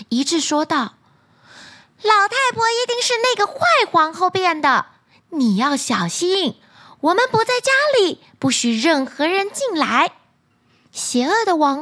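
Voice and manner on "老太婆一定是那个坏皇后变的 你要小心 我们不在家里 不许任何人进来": dwarf voice. Acted.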